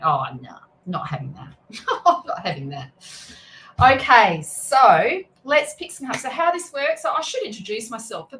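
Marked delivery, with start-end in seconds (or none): 0.0-2.9 laughing mock refusal. Retelling refusing spirit's name.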